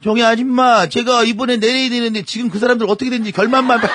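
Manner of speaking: high-pitched, urgent begging; hammed-up desperate auntie with laughter creeping in; pleading stranger auntie. A narrator's reading. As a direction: butt in and beg for the ending. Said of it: "갑자기 뒤에서 모르는 아줌마가 다짜고짜 다가왔어요".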